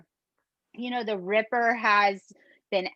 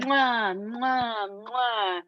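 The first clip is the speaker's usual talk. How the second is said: big wet smooch; much slower than usual. Pretend. Blowing kisses to listeners.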